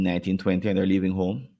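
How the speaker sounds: ordinary talk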